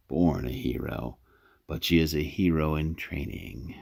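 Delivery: ordinary talk, nothing marked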